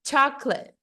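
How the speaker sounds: English chocolate in two syllables, stress up front; English intonation. Shown as a demonstration. Teacher models the word in English, falling two-syllable chocolate. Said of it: "no inglês".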